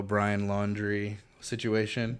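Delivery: ordinary delivery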